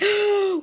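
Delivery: sharp high gasp of horror; big dramatic intake of breath; loud shocked gasp. Retold or imitated. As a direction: gasp like you caught someone doing something awful. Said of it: "my friend skipped in and went".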